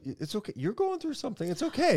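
very high, squeaky reassurance; high, soothing squeak; high-pitched comforting. Real, not acted. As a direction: console them in a tiny falsetto. Comforts tearful co-host in high voice.

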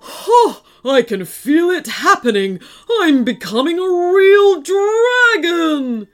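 loud, building monster exultation; villain crowing as the change hits; exultant dragon. Acted. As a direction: climb high into a triumphant roar.

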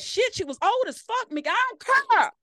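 high, loud mock God voice; God brushing off her grief; God's reply. God answering her anger about her mother, high mock dismissal. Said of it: "he gonna be like".

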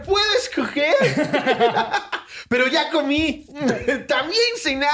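put-on high, laughing amazed child; mock kid overjoyed about dinner; astonished kid. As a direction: falsetto disbelief at getting a choice. Acted boy asked about dinner.

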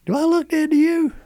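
very high, incredulous question; retold indignant retort. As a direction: throw it back as an absurd question. Indignant retort to frantic wife.

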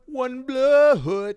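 put-on high, loud Jamaican accent singing. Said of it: "Do the Jamaican accent".

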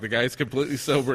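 ordinary talk, nothing marked

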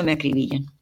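ordinary delivery, nothing marked

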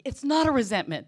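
stubborn protest, half-mock; indignant denial. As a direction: push back hard and loud against the verdict. Acted quarrel with the sponsor.

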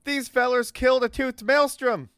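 loud, high, proud proclamation; excited announcement. Acted. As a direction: announce it to the whole crew. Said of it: "looks at his crew and says".

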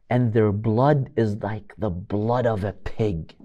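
low, soft and slow, a quiet poisonous aside; sly insinuation. Retold or imitated. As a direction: drip it in his ear. Umar quietly instigates Abu Jandal.

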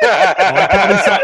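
high, loud, through laughter; laughing. Real, not acted. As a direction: crack up while answering. Cracking up at co-host's teaser.

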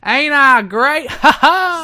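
high, loud, drawn-out wrestler boast; Jeff Jarrett. Retold or imitated. Cohost echoes wrestler's catchphrase, loud crowing impression. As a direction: crow it slowly, capped with a mock laugh. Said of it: "a, a modest impression of Jeff Jarrett".

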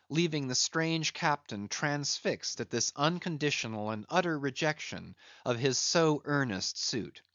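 ordinary delivery, nothing marked

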